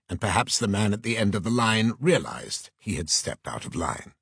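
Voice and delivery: ordinary delivery, nothing marked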